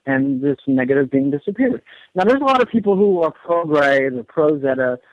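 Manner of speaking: ordinary talk, nothing marked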